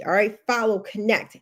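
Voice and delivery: usual speaking voice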